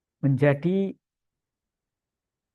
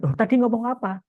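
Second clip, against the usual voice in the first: higher-pitched puzzled question; acted curiosity, pitched up; curious listener; 7 semitones higher than usual. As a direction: imitate an audience member suddenly wondering.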